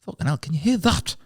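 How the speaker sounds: startled Newcastle seller hearing the planes; mock Geordie fright; Geordie alarm. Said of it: "he goes".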